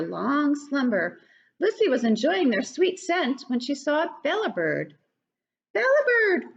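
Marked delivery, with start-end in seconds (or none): 5.7-6.4 high, louder call of the name. Acted. Lucy calling to the fish, high and loud. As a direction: call across the pond, high. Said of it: "called Lucy".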